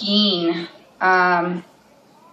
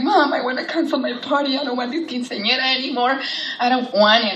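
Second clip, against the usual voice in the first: daughter pleading; 6 semitones higher, faster than usual. Retold or imitated. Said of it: "screaming next to me".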